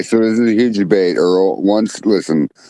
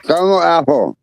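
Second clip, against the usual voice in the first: tongue held between fingers; holding own tongue; 7 semitones higher than usual. Shown as a demonstration. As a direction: say it while pinching your tongue. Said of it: "say caramel apple while holding your tongue".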